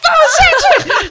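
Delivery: high, loud slowed yell; desperate shout. Retold or imitated. Rider begging her friend to let them down, high panicked shout. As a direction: beg to be let down at the top of your lungs. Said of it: "我说".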